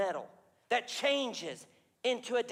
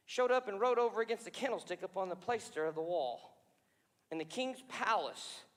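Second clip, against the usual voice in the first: ordinary talk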